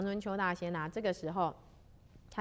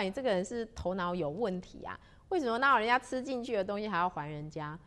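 ordinary delivery

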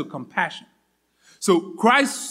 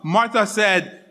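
ordinary talk, nothing marked

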